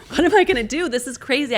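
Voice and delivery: half-laughing replay of frantic worry; retold panic. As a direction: fret it out fast, pitch jumping. Retold panic over losing job.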